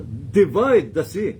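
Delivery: slow, loud divine command. Acted. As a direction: boom it out as God ordering the sea. God commands Moses, preacher voicing.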